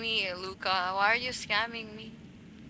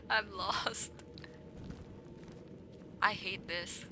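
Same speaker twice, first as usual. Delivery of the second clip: breathy whisper, half laughing; 3 semitones lower, 13 dB quieter than usual. Real, not acted. Gamer whispering to herself while lost.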